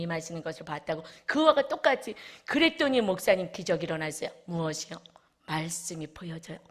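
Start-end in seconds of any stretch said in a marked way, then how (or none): 5.5-6.6 whispered softly, voiceless; awed whisper. Real, not acted. Preacher tells the church the Word becomes visible, whispering. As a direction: breathe it like a secret wonder.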